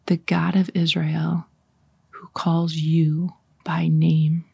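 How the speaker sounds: very slow, spacious scripture reading. Shown as a demonstration. Leader rereads the same Bible verse for meditation, slow reading. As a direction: linger on each phrase, long pauses between. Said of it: "I'm gonna read it a little bit slower".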